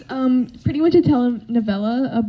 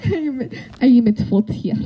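put-on Soviet accent. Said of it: "Soviet boy starting it up".

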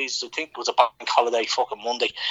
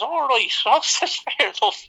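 nervous laugh; 13 semitones higher, slower than usual. Retold or imitated. Nervous reply retold.

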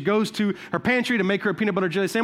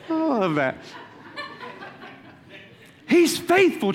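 ordinary delivery

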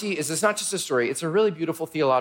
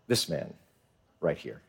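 quiet accusation; 10 dB quieter than usual. Acted. Esther accuses Haman.

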